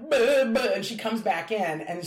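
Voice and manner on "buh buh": high, louder blubbering sputter; choked-up stammer, half acted; retold crying sputter. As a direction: sputter through mock sobs. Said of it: "And so I'm like".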